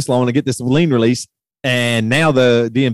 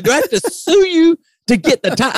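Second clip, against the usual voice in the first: retold exasperated outburst; 13 semitones higher than usual. Retold angry phone call.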